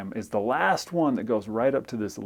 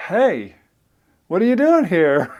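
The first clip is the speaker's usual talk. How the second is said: groggy surprise; 7 semitones higher, 8 dB louder than usual. Retold or imitated. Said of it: "and he's like".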